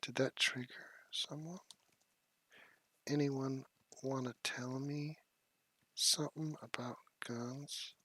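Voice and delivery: soft, slow mocking taunt; quiet teasing dare to the chat, drawn out; hushed taunt. Real, not acted.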